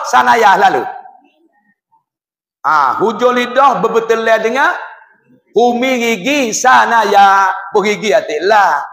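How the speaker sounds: usual speaking voice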